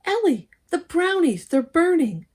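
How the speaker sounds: put-on high, loud alarmed cry of a girl; alarmed shout. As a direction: shout the alarm about the burning brownies.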